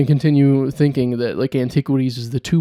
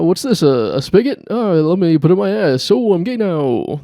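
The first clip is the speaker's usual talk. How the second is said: high, excited manic Robin Williams riff; Robin Williams impression; 4 semitones higher, 4 dB louder than usual. Podcaster riffing on Robin Williams in the shower, manic and high. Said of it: "That's Robin Williams".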